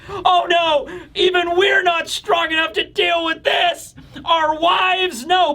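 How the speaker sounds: high, hammed-up cries of despair; mock dismay. Giant pirates facing their nightmare wives, comic high-pitched panic. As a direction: wail like big warriors losing their nerve. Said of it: "giant warrior pirates are just like".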